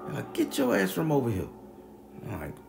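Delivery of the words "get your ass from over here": high-pitched order to leave, lightly acted; imitated scolding neighbor. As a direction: shoo him off as the neighbor would.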